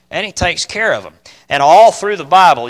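ordinary talk, nothing marked

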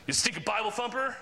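high, mock-indignant insult; all mad. Upset person insulting the churchgoer, acted irritation. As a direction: imitate someone annoyed, throwing an insult. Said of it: "they get all mad".

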